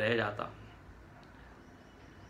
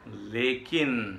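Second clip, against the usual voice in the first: लेकिन very slow, falling away; a slow, lingering लेकिन; drawn-out catch; 3 semitones lower, much slower than usual. Retold or imitated. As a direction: stretch लेकिन out and let it hang. Repeats the catch, teasingly drawn out.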